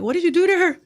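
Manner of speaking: high-pitched accusing question; mock-alarmed, pitched well up; imitated alarmed relatives. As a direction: act out relatives demanding an explanation. Family reacting to sister crying.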